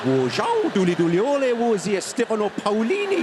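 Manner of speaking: Chinese in a broad, lilting Geordie accent. Shown as a demonstration. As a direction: speak Chinese like a loud Geordie. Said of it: "Chinese with a Geordie accent".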